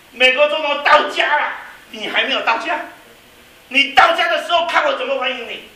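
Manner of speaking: preacher booming out God's promise of a homecoming. Acted. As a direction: declare it as God promising a grand welcome. God's voice answering the missionary.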